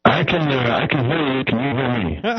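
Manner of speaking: loud, distorted phone line; blown-out, fuzzy call audio. Real, not acted. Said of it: "you sound all distorted and crazy right now".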